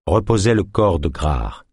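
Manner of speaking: ordinary delivery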